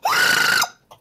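loud, very high-pitched squeal; scream. Pretend. Friend jokes about warping the voice, high scream. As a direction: squeal at the top of the range. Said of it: "yeah, that was Scream accent".

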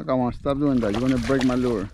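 normal talking voice

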